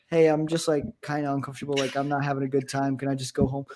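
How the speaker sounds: softly voiced polite let-down; quiet, mild imitation of an awkward date; imagined polite excuse. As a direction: say it low-key, a touch hesitant. Voices what date should have said.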